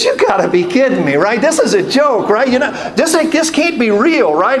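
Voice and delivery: high-pitched incredulous protest; panicked disbelief. Retold or imitated. Passenger to pilot who faints in clouds, high incredulous alarm. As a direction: plead that it must be a joke, voice up high.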